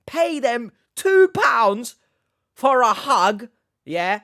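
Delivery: high, loud and drawn out; loud, comically outraged emphasis; incredulous outburst. Real, not acted. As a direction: shout each word in disbelief. Incredulous rant about paying for hugs.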